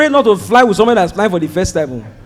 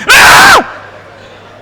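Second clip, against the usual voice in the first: high startled yelp; sharp outraged cry; shocked cry; 3 dB louder than usual. Retold or imitated. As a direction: yelp in disbelief. Outraged at another stop.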